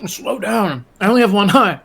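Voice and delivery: high, strained plea; calling after her. Acted. Billy begs the sprinting woman to wait, high plea.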